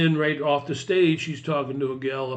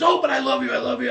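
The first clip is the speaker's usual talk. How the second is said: loud, high mimicked gushing; overexcited gushing; 11 semitones higher, 4 dB louder, much faster than usual. Overexcited lady pleading past Teresa's refusal, gushing. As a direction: imitate an overexcited woman insisting in a high voice. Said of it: "overexcited lady".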